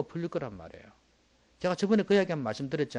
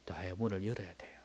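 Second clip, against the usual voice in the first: whisper; 7 semitones lower, 9 dB quieter than usual. Real, not acted. Preacher drops to whisper.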